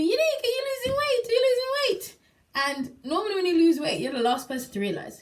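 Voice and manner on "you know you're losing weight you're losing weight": high voice full of praise; admiring onlookers. Retold or imitated. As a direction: gush over her like an impressed friend. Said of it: "People are saying to me".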